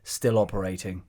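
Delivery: lightly voiced solemn documentary talking head; documentary interviewer voice. Playing a character in every documentary, low interviewer voice. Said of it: "What is that voice?".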